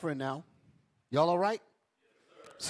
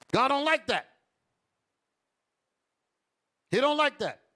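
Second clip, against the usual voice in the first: higher and louder, hammering each word; preaching shout; 7 semitones higher, 6 dB louder than usual. Real, not acted. Preacher shouting to the congregation about God's displeasure. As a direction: thunder it at the congregation. Preacher driving point home.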